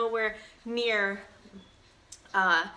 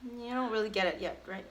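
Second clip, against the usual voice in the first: ordinary delivery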